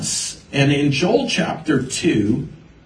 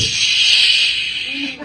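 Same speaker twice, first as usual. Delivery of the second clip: a sharp unvoiced shush, much higher; 11 semitones higher than usual. Man in church foyer hushing a curious teen, urgent shush. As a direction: hush him fast and hard. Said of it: "He goes".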